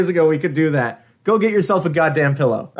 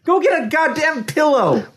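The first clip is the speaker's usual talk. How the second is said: exasperated yell; 10 semitones higher than usual. Real, not acted. Scolding friend lying on floor.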